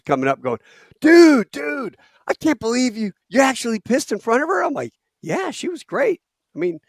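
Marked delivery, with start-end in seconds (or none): 1.0-4.6 high, loud, incredulous bro voice; amazed buddies. Retold or imitated. 5.2-6.2 lightly high reply. Retold or imitated.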